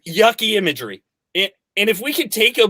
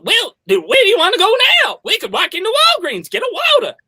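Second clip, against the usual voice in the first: a much higher Texan twang copying her; mimicked Texas accent; 13 semitones higher, 3 dB louder than usual. Coach mimicking the Texan client in a higher Texas twang. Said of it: "I thought you were my dad".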